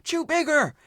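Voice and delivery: high, loud director's yell; screaming. Retold or imitated. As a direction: scream the order at the actor. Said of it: "they kept screaming".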